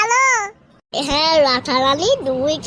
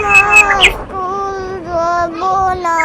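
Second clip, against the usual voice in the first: sleepy refusal; much slower than usual. Acted. Sleepy child refusing school.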